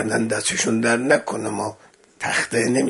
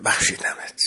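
soft hushed pardon; whispered forgiveness; 4 dB quieter than usual. Acted. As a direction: forgive her in a gentle whisper. Yusuf forgives Zuleikha. (بخشش نجواگونه)

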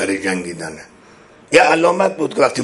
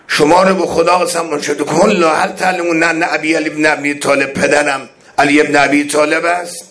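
higher, louder pleading call to the army; imploring imam; 5 semitones higher, 4 dB louder than usual. Acted. Imam calls out to the enemy army, higher and louder beseeching. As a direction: beseech them by God to recognize you. Voices Imam's oath-pleas.

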